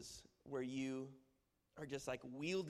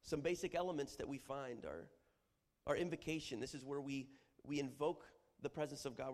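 ordinary talk

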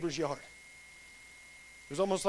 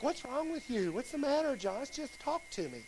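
soft, higher-pitched fussing mom; quietly fretting mother impression; mom's worried voice; 6 semitones higher, 5 dB quieter than usual. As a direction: imitate a concerned mother, hushed and pleading. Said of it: "That's how my mom talks".

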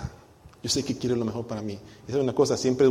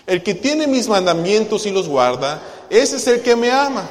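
loud, slow, emphatic reading of scripture; loud group reading; 7 semitones higher, 8 dB louder, slower than usual. Shown as a demonstration. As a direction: read it out strong with the whole church. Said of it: "todos juntos leamos los versículos". (lectura en voz alta, lenta y enfática)

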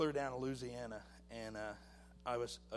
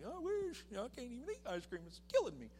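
much higher, fast, exasperated; the sick man answering people who think he overeats; exasperated self-quote; 10 semitones higher than usual. Retold or imitated. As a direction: rattle it off high and fed up. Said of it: "And I'm like".

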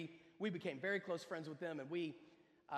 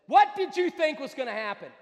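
loud, higher, incredulous shout; exasperated outburst; 8 semitones higher, 15 dB louder than usual. Acted. Preacher puts the question after the story, exasperated shout. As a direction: fire the question out at full volume.

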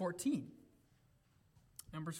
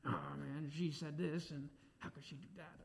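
low, quiet grumble; acted-out private griping; grumbling; 5 semitones lower, 9 dB quieter than usual. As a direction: complain under your breath, voice sinking away. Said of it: "You ever grumble in your circumstances?".

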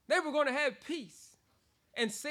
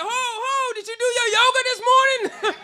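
high, loud teasing through laughter; laughing tease; 12 semitones higher, 12 dB louder than usual. Retold or imitated. Mocking a coworker who claimed yoga gave her peace.

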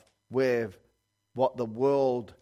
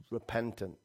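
ordinary delivery

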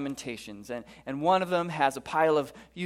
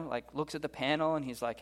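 ordinary delivery

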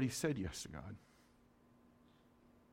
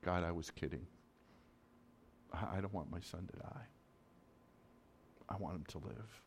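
much softer, low, broken plea; desperate prayer; 5 semitones lower, 11 dB quieter than usual. Retold or imitated. Father praying in the emergency room, hushed and pleading. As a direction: pray it barely aloud. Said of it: "I said this".

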